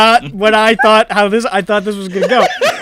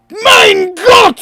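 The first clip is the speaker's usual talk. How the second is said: high, loud shriek of a fleeing man; panicked German cry; 12 semitones higher, 9 dB louder than usual. Acted. Fleeing NPC glancing back at the flying Jane, high panicked shout. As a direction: yelp it in terror over your shoulder. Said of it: "shoulder and goes".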